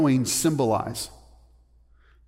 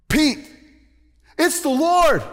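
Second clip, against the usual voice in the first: high, loud joyful shout; excited calling out; 12 semitones higher, 6 dB louder, slower than usual. Acted. Disciple calling out to Peter that it's the Lord, excited shout. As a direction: cry it out across the water.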